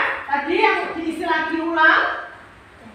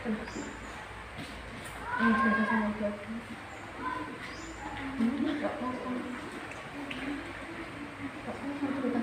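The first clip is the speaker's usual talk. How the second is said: soft comforting; 3 semitones lower, 11 dB quieter, faster than usual. Real, not acted. Comforting a crying pupil.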